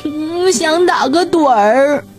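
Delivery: slow, drowsy and yawning; heavy-lidded sleepy dog. A narrator's reading. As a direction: drag the words like you can barely stay awake. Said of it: "想睡觉了".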